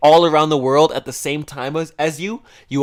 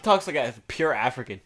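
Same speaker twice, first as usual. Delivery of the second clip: usual speaking voice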